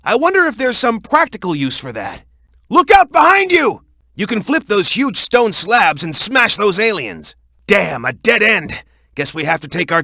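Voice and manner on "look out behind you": high, loud urgent cry; sharp warning shout. Acted. Ally warning Shadow of an enemy behind him, high urgent shout. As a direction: snap a warning at his back.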